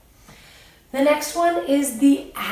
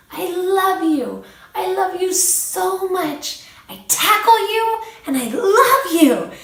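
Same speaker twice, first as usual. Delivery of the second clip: put-on high, slow, gushing little-boy voice; imitating toddler Ryland; 8 semitones higher, 5 dB louder than usual. Mother imitating her small son telling a friend he loves him, gushing. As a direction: coo it like an exuberant toddler. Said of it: "he said it just like that".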